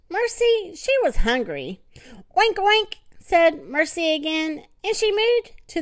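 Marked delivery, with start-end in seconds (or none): 2.4-3.0 pig oink. Acted. Hungry pig speaks.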